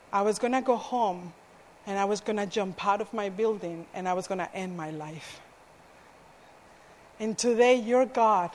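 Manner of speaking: hushed confession. Retold or imitated. Girl confesses suicide plan.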